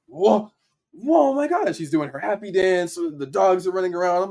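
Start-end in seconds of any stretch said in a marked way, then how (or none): 0.1-1.7 high, loud retold amazement; amazed godmom. Imitating godmom on hearing of the Nike campaign, thrilled. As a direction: imitate her whooping at big news.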